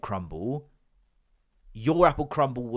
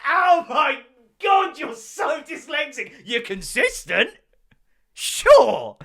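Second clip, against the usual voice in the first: much higher, shouted and slow; incredulous shriek; 13 semitones higher, 7 dB louder, slower than usual. Retold or imitated. To Bill over the misspelt name, a high incredulous shout. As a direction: squeal in disbelief at him.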